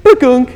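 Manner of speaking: imitated high electronic bukunk; mock GPS beep; GPS chime noise. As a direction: make the phone's alert sound with your mouth. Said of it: "the G P S goes".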